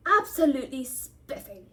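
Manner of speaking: loud, hammed-up enthusiasm; exaggerated, grandiose relish; mock-pompous. A narrator's reading. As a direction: gush like a parody gentleman. Twins parody Percy's pompous greeting.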